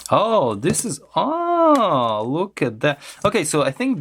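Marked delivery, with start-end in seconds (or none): 1.1-2.2 high, drawn-out, held "aah"; long "ah" of discovery. Real, not acted. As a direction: let out a high "ah" of realization. Discovers how the charger is built.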